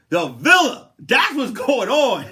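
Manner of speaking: loud, high, slow gloating; gloating shout. Retold or imitated. Telling the jealous ex what's going on, loud gloating. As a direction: rub it in, loud and proud.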